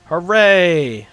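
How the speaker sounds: loud happy cheer. Real, not acted. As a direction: celebrate the open door. Player cheers door opening.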